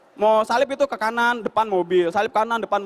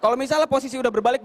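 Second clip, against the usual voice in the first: ordinary delivery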